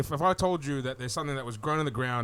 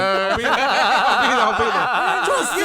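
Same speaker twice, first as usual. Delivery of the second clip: drawn-out, louder "I'll be there"; sing-song tease; 6 semitones higher, 10 dB louder, much slower than usual. Pretend. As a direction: croon it teasingly. Teasing poaching a guest.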